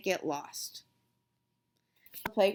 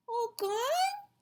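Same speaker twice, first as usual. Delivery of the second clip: high, small questioning voice; toddler asking; 14 semitones higher, 3 dB quieter than usual. A narrator's reading. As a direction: ask it like a tiny child. Tearful toddler asks.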